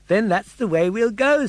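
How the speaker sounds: put-on high eager puppet boy; bright young puppet voice; Pinocchio voice. Pinocchio's lines.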